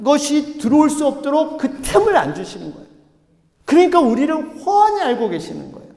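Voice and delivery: high, loud, emphatic sermon delivery; impassioned preaching. Real, not acted. Pastor explaining how God surrounds us, impassioned preaching. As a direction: drive it home at full pulpit force.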